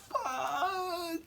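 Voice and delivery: high, thin mock whine; mortified squeal. Daughter watching grandpa strip, mortified squeal. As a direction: squeak it out like someone dying of embarrassment.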